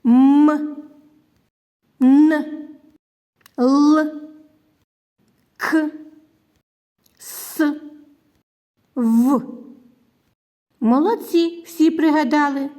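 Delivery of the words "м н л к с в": М, Н, Л held as hums, К, С, В as bare sounds; phonics-style consonants, one by one; isolated consonant sounds. Shown as a demonstration. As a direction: sound out each consonant alone, without letter names. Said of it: "які позначають приголосні звуки".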